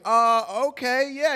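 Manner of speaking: high, hesitant yes; nervous agreement. Retold or imitated. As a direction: go along though out of your depth. Nervously goes along with her.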